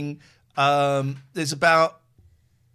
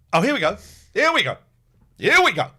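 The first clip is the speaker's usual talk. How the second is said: louder here we go, building up; loud anticipation; 9 dB louder, faster than usual. Real, not acted. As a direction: call it out as the sneeze comes. Girlfriend sneezing again.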